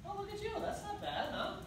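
praising a puppy for heeling, in a high voice; high dog praise. Real, not acted. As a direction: coo at the dog like it just did something clever.

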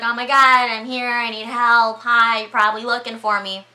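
imitating a stranded woman hailing a rescuer; calling for help. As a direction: call out urgently to someone far off. Michelle calling to a distant plane.

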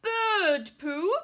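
high, loud disgusted outburst; disgusted knight. Acted. Knight hit by bird poo, disgusted. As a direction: spit it out in disgust. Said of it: "scowled the knight".

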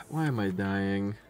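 normal talking voice

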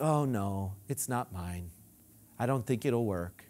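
low, soft, mopey refusal; doubtful refusal. Retold or imitated. Preacher mimics a doubter refusing the gift, low and mopey. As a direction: mumble it like you don't believe it.

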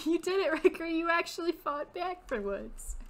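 high, excited congratulation; delighted, high-pitched praise; excited cheer. Acted. Character cheers Riker in game.

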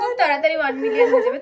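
excited. Real, not acted. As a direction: hype it up fast. Excited repeat of milestone.

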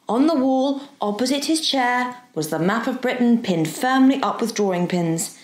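usual speaking voice

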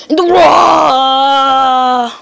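slow, loud wailing cry; a long drawn-out wailing shout. Acted. Wailing while being found.

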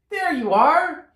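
high, loud, happy squirrel. A narrator's reading. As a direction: greet the hat like a squirrel. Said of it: "said to his hat".

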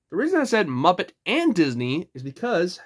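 ordinary talk

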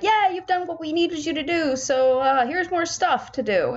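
lightly voiced demanding game; mock game voice. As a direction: voice the game piling on tasks. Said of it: "or else it'll be like".

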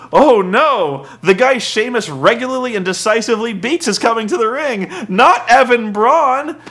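high, hammed-up horror, slowing at the end; mock dismay. Mock dismay at weak opponent.

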